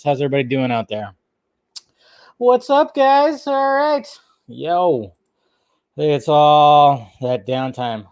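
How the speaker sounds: ordinary talk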